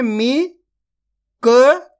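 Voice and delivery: "मी, क," as separate drawn-out syllables, a pause between. Shown as a demonstration. Teacher showing learners how to read a word, syllable by syllable. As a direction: sound out "मी, क," one syllable at a time. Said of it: "एक अक्षर का उच्चारण करना है".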